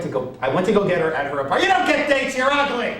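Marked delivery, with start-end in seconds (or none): 1.6-2.9 high, loud taunt; heckler shout. Retold or imitated. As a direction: yell to sink the comic. Heckler interrupting.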